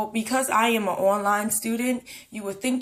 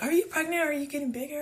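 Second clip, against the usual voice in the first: nosy question; 7 semitones higher, 4 dB quieter, faster than usual. Retold or imitated. Quoting nosy people.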